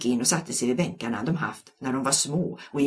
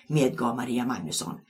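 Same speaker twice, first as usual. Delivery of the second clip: ordinary delivery, nothing marked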